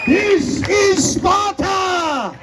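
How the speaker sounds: slow, high roaring battle cry; battle cry shout. Acted. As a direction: bellow it like a warrior. Quotes movie battle cry.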